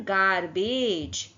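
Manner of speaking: drawn-out "गारबेज", hard g then final j; hard and soft G. Shown as a demonstration. Teacher giving the word's pronunciation, slow demonstration. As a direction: sound out "गारबेज" slowly in two syllables. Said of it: "इसका pronunciation क्या हो जाएगा".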